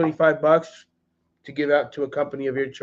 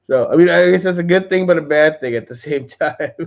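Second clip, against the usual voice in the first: usual speaking voice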